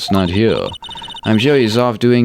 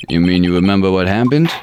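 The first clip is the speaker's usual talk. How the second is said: ordinary talk, nothing marked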